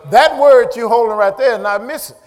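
full-voiced, emphatic preaching. Real, not acted. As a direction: press the point on the congregation.

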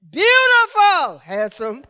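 loud, high, drawn-out proclamation; proclaiming. Acted. Preacher feeds praise words to congregation, proclaiming loudly. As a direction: belt it slowly to the congregation.

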